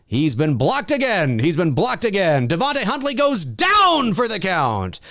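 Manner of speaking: hammed-up play-by-play; mock fight announcer. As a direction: call the knockout like a commentator, rising to the finish. Mock commentary on blocking a chatter.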